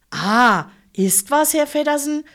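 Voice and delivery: a driver calling down the empty bus, loud and high. Acted. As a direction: shout it to the back from the driver's seat. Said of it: "rief es aus dem Führerstand".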